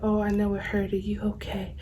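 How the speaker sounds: put-on hushed boyfriend. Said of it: "he's like".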